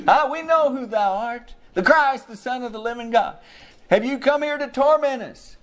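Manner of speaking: high, fearful cry of a demon; cried out in fear. Retold or imitated. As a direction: plead high and scared, like the demons before Jesus. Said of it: "They cried out in fear".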